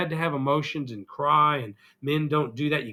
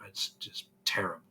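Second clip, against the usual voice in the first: breathless whisper, no voice at all; 7 semitones lower, 9 dB quieter than usual. Real, not acted. Telling viewers how sick he's been, in a whisper. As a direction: let it fall to a whisper. Sick, describing feeling awful.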